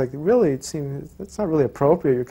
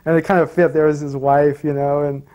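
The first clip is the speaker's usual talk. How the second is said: ordinary delivery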